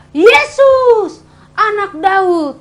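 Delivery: loud, high cry for help, slowing on the second phrase; desperate shout. Acted. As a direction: call out to be heard over a crowd. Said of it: "dia langsung berteriak".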